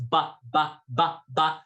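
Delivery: "ba" placed forward on the lips; forward lip placement. Shown as a demonstration. Teacher showing students lip placement, forward voice. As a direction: say each ba lightly off the front of the mouth. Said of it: "feel kind of forward with your lips".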